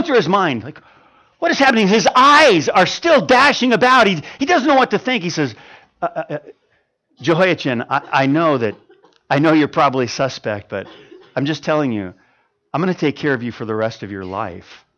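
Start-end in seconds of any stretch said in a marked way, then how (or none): 6.0-14.8 soft, hesitant reassurance; kind king speaking softly. Acted. King reassures the suspicious prisoner, softly and gently. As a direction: gently promise to care for him. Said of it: "he spoke kindly to him".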